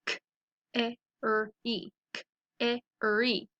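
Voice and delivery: "carry" sound by sound, k, eh, r, ee; segmented phonics "carry". Shown as a demonstration. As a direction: sound out "carry" twice with gaps. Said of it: "So let's practice them".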